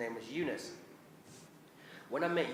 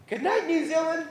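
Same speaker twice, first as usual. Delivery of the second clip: high, loud cartoon-bird sign-off; little kiwi bird; 14 semitones higher, 8 dB louder than usual. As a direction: chirp a bedtime farewell like a TV mascot. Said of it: "a little Kiwi bird would come on".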